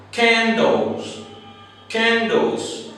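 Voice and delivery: "Candles" with a strong aspirated K; hard K sound. Shown as a demonstration. Teacher modelling the word candles for learners. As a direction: punch the K of "Candles". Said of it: "I want you to listen carefully".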